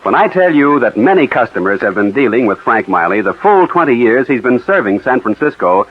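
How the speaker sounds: ordinary talk, nothing marked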